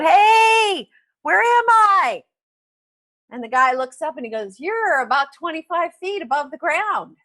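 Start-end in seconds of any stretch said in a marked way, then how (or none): 0.0-2.2 high, drawn-out call to someone below; yelling down. Retold or imitated. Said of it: "he yells down". 4.6-7.2 higher, softly projected reply; calling back up. Retold or imitated. Man on ground calls back up.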